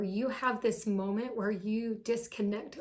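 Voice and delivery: normal talking voice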